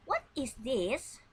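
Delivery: slow, rising teacher question; slow model question. Shown as a demonstration. Teacher modelling a question for pupils, slow and rising. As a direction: ask it slowly for the class to copy. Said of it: "let's try this dialogue".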